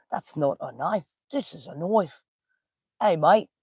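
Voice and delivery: badly faked Australian movie-quote accent. Livestreamer jokes with Australian viewer, butchered Australian accent. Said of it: "I must have butchered that one".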